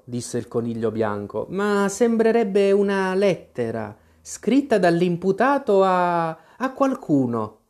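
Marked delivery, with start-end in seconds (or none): 1.5-7.6 nervous rabbit. Acted. Rabbit voiced figure.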